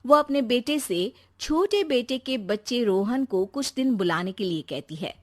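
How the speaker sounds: plain reading voice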